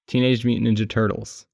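normal talking voice

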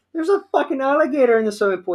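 high, fast frantic blurt; panicked report. Retold or imitated. Telling dad about the alligator in the pool, a high, fast panicked blurt.

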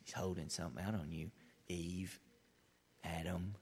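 the crafty serpent sowing doubt in Eve; sly serpent. Acted. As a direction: lure her in like a serpent. Serpent whispering to Eve and Adam.

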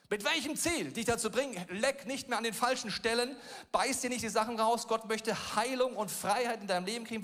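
full-force preacher; preaching. Real, not acted. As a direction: drive it home to the congregation.

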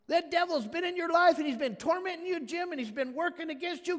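loud, high, rising sermon climb; full-force preaching. Real, not acted. Pastor exhorting Jim, full-force preaching. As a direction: drive it home to the congregation.

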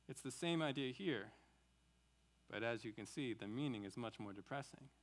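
ordinary talk, nothing marked

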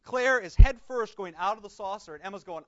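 normal talking voice

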